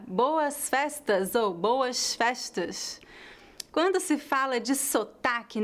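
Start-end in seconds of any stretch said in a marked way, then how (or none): 1.5-3.0 slow Rio accent, S turned to sh; Carioca accent. Shown as a demonstration. Teacher comparing two pronunciations, Carioca accent. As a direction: say it the Carioca way, hushing each S. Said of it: "qual é a pronúncia correta?".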